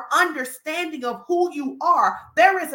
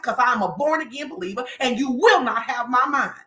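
normal talking voice

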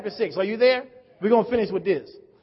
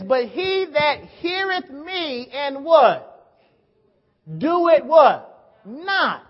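emphatic reading with loud prompting questions; declaimed scripture; 5 semitones higher, 6 dB louder, much slower than usual. Real, not acted. Preacher quizzing congregation on the verse, loud emphatic declamation. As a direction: proclaim it, punching each prompt to the crowd.

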